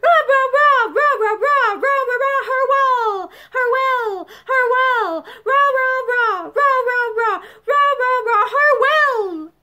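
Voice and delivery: high, loud chanted rah rah; college cheer. Acted. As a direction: cheer the team with all your lungs.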